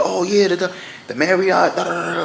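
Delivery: high, eager hustler voice; mock friendly attendant voice; parking attendant.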